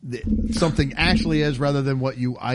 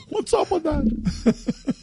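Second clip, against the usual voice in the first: slow, much higher Seinfeld bit, laughing through it; laughing Seinfeld impression, drawn out and pitched up; 13 semitones higher, much slower than usual. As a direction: stretch it out like a Seinfeld observation. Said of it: "That was, uh, Seinfeld".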